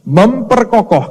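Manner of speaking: Memperkokoh drawn out slowly and pitched high; emphasis on Memperkokoh. Real, not acted. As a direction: underline Memperkokoh with a high, slow delivery. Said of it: "garis bawahi".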